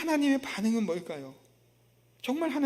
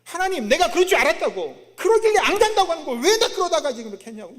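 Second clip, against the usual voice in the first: loud, high mock complaint to God; jeering, accusing outburst; sarcastic griping; 7 semitones higher, 9 dB louder than usual. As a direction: act out Jonah's scornful protest, pitched up. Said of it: "비꼬는 겁니다".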